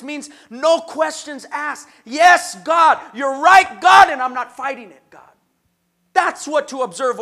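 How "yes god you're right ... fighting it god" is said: loud, fervent cry of yes to God; loud declaration to God; shouted prayer. Acted. Voicing an obedient prayer to God.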